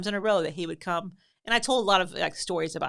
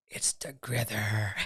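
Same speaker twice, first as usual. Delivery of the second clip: slow, very low monster rasp-whisper; creature voice; 9 semitones lower, much slower than usual. Retold or imitated. Prankster as the Grither at the boys' door, creepy whisper. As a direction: draw it out like a lurking monster.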